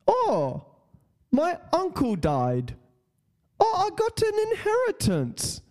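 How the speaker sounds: high, hammed-up mock English accent. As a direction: play an excited scammer in a put-on English accent. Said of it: "I don't know why they sound English".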